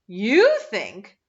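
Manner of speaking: a loud scornful "You think?"; loud, contemptuous retort; utmost contempt. A narrator's reading. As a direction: sneer at the very idea. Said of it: "voice expressed the utmost contempt".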